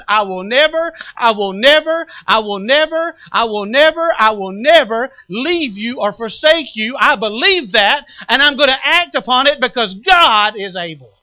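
loud, high, hammering repeated never; declaring faith at full voice; fervent preaching. Acted. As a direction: preach it with rising fire.

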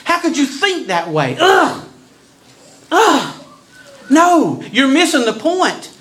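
appalled disbelief. Retold or imitated. Paul answering the question about sin and grace, appalled. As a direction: sound scandalised by the very idea. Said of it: "He's going".

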